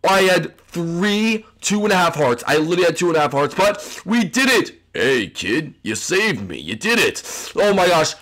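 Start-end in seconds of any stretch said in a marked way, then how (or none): 4.9-7.2 low-pitched, put-on hero voice; Han Solo. Rescued captive thanking the kid, low hero voice. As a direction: play the rescued captain, deep and cool. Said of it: "Han Solo".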